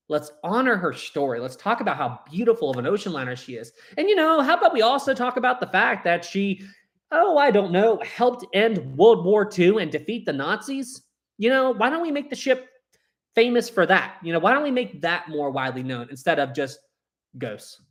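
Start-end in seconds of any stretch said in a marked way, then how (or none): 4.0-15.5 higher, sarcastic "oh, I don't know" jab; a pointed, raised-pitch sarcastic aside. Real, not acted. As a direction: needle the ghost hunters with mock innocence. Sarcastic rant about the ship's fame.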